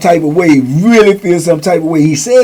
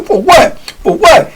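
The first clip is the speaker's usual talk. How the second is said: very high, agitated retold retort; agitated challenge; 11 semitones higher, 3 dB louder, slower than usual. Batter coming up at approaching man, high agitated challenge. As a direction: repeat it sharply, pitch jumping right up. Retold dude comes up with bat.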